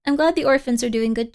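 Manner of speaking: usual speaking voice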